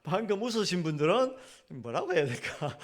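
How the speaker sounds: half-laughing; amused, chuckling while speaking. Real, not acted. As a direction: repeat the line through a light laugh.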